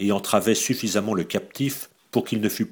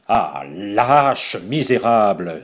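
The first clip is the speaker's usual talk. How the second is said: much louder, indignant; outraged cry; 7 dB louder, much slower than usual. A narrator's reading. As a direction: hurl the insults at her. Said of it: "s'écria-t-il". (Raoul s'écrie, indigné, fort)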